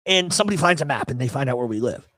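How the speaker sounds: ordinary talk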